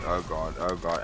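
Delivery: ordinary talk, nothing marked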